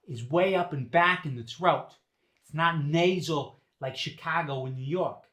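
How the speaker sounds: Boston dialect, resonance back in the throat; Boston accent. Shown as a demonstration. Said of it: "Now, for the Boston resonance".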